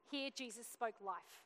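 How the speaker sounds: ordinary talk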